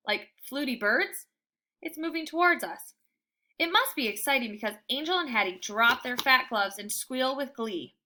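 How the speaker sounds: usual speaking voice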